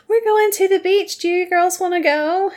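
high, singsong mock girl's voice; Sugar. Sugar inviting the girls to the beach, high put-on voice. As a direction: pitch it way up for an excited-sounding invitation. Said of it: "Sugar comes in and is like".

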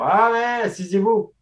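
high, loud, exaggerated Acadian welcome; playing up the local accent for a visitor; hammed-up Acadian hostess. A guide at the Pays de la Sagouine welcomes a visitor, hammed-up and high. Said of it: "puis j'ai fait comme".

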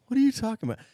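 high-pitched, indignant buddy impression; Jeremy. Buddy denies to teller that he got beaten, high-pitched imitation. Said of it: "like, higher pitch".

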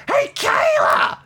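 very high, drawn-out catchphrase shout; Billie Kay. Retold or imitated. Podcast host imitating a wrestler's online videos, very high mock shout. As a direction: shriek it out like a hammed-up impersonation. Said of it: "videos online of, uh, Billie Kay going".

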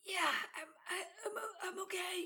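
high, soft, faltering mock whimper. Teasing the hurt partner's high voice, mock whimper. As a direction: imitate her partner's shaky claim to be fine. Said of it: "And you're just like".

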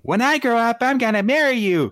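put-on high, loud childish declaration; little kid. Little kid promising marriage to a big sister figure, high kid voice. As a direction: pipe up like an eager little kid. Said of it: "a little kid saying".

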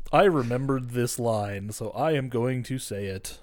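slow, stilted line reading; deliberate, lifeless imitation of reciting; mock wooden acting. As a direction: recite it like a bad actor remembering lines. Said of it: "he is just kinda like".

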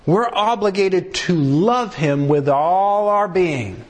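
normal talking voice

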